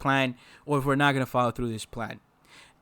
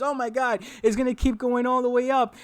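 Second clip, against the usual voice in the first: higher, mock-panicked trader crying out; 8 semitones higher than usual. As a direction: play the scared trader watching green bars. Panicking retail trader voiced.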